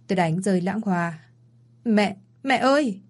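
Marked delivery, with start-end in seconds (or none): 1.8-3.0 slow, drawn-out frightened call of mom; anguished slow call to mother; panicked cry for mother. Acted. Daughter hears mother hospitalized.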